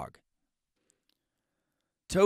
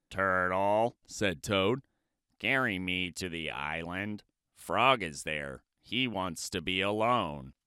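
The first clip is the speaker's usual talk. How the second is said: lower, softer pleading Toad; Toad's low voice; 8 semitones lower, 5 dB quieter than usual. Acted. Toad asking the turtle for a ride.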